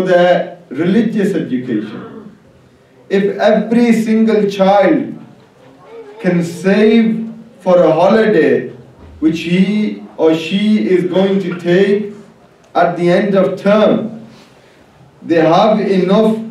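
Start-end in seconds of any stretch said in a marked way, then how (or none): none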